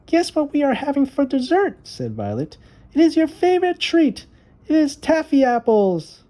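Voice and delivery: a sister gleefully teasing her brother; little girl Violet. A narrator's reading. Little sister teasing her brother about taffy apples, excited high voice.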